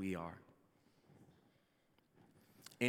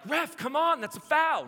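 much higher, loud mock yell at the TV; yelling at the ref; 11 dB louder than usual. Soccer fan pastor shouting at the referee on TV, loud. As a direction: bark at the referee like a frustrated fan. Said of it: "I'm yelling at referees".

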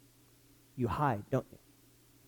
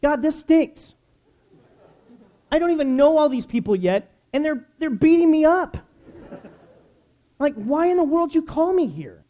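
much higher, much louder exasperated outburst; frustrated complaint to God; 11 semitones higher, 7 dB louder than usual. Retold or imitated. Lonely new youth pastor complaining to God, exasperated outburst. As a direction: vent at God like he is beating you up. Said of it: "having a conversation with the Lord".

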